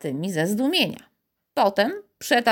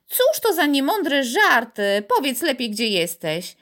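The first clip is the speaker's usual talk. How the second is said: exasperated cry; 6 semitones higher, 3 dB louder than usual. A narrator's reading. Piotr scolding his invisible playmate, exasperated shout. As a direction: demand an answer, raised voice. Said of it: "krzyknął".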